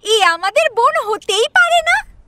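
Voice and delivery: put-on a girl's high, scornful voice; high-pitched sneering child; disgusted young girl. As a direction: wrinkle your nose at the baby, spit it out. Girl sneers at ugly newborn.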